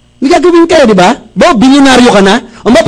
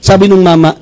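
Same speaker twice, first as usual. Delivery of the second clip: normal talking voice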